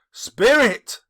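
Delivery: a loud pleading call to the spirit; urgent cry. A narrator's reading. Scrooge begging the ghost to stop, loud. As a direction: call out to him, desperate. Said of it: "Spirit said Scrooge".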